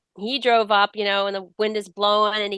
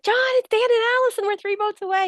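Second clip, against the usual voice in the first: very high, rushed radio hail; excited call. Retold or imitated. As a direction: hail an old friend, pitch soaring.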